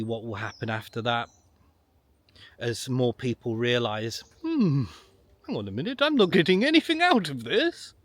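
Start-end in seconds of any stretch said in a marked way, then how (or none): 4.4-7.9 high, hammed-up sudden doubt; loud, high mock indignation; mock dawning realisation. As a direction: play someone suddenly catching on, pitch leaping up. Acts out people suddenly realising.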